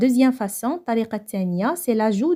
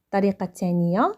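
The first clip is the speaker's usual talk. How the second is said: ordinary talk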